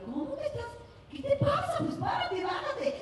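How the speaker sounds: high-pitched frantic urging; alarmed urging. Retold or imitated. Retells urging driver to stop. (la conductora urgiendo al chofer a detenerse, muy aguda y alarmada)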